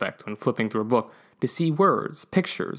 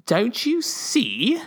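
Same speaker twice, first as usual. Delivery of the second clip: ominous warning; 11 semitones higher, much slower than usual. Acted. Ambassador warns of trouble.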